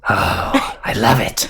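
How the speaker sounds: put-on creature voice; delighted snake guard. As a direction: coo approval as a monster. Snake guard likes nickname.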